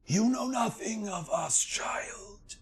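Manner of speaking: breathy high hissed whisper; whispering bird spirit. Acted. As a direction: hiss it like an ancient bird.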